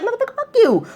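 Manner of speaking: high, sharp retort; snapping back. Retold or imitated. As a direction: fire the insult right back. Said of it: "snap back like".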